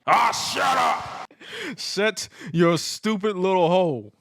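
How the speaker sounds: high, loud, slow shouted retort; angry yell. Retold or imitated. Jericho shouting at Stone Cold, angry and loud. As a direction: snap back at him, shouting.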